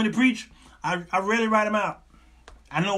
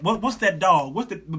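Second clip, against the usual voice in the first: ordinary delivery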